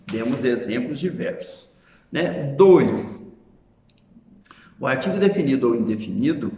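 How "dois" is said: emphatic item number. Real, not acted. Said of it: "calling out item number".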